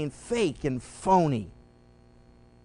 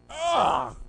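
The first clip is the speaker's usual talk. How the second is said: disgusted ugh; 5 semitones higher than usual. Real, not acted. Disgust at hypocrisy.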